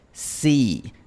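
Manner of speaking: sí with a glide added at the end; English-style sí. Shown as a demonstration. Teacher contrasting English I with the Spanish word. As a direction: say Sí sliding off the vowel. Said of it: "a la I inglesa en sí".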